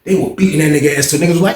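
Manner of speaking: ordinary talk, nothing marked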